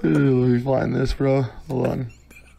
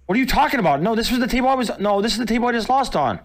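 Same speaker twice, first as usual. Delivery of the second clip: high, disbelieving objection; incredulous protest; 10 semitones higher, faster than usual. Real, not acted. Gambler disputing which table he lost on, incredulous protest.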